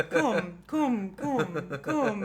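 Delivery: "coom" with a long oo for "cum"; mispronounced by truck. Retold or imitated. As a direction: repeat "coom" as separate words, imitating the truck's wrong vowel. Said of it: "she was saying it wrong".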